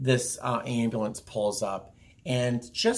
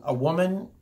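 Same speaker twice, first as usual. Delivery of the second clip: usual speaking voice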